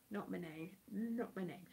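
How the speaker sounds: softly repeating not my name; quiet muttered repeat. Real, not acted. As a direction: mutter it under breath. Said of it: "that's not my name".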